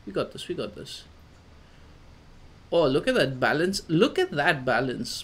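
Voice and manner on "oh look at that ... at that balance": loud, impressed exclamation, pitch bouncing; excited amazement. Real, not acted. Player admiring his climber's balance, excited amazement. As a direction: marvel out loud, thrilled.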